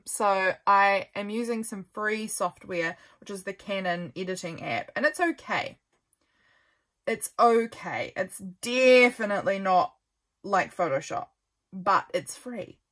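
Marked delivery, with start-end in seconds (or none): 5.0-5.8 higher, lifted "it's okay" with "okay" falling; a quick, upbeat first verdict. Real, not acted. First verdict on the free app. 7.1-8.1 slow, settled "It's okay" with a falling "okay"; resigned okay. Real, not acted. Repeats the verdict, resigned.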